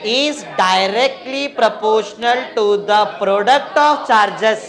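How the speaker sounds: loud, very slow, evenly beaten syllables; stretched-out, forceful teacher-led drill; class recitation. Real, not acted. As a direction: chant the rule for the class to repeat. Leading class in reciting statement.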